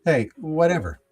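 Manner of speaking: ordinary talk, nothing marked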